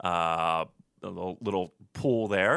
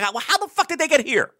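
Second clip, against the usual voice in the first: exasperated; 5 dB louder than usual. Retold or imitated. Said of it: "I'd be like".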